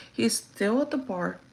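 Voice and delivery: ordinary delivery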